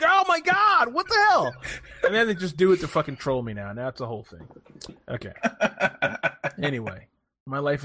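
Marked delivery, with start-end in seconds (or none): 0.0-1.5 much higher, shocked outburst; exasperated exclaim. Retold or imitated. Retelling a Discord burper, exasperated high outburst. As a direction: throw up your hands in disbelief. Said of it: "I'm like".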